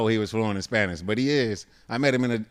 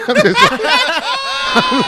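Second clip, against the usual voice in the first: laughing; 10 dB louder, much slower than usual. Real, not acted. Laughing at the offer.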